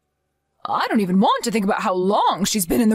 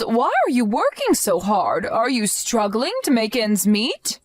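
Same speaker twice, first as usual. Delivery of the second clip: normal talking voice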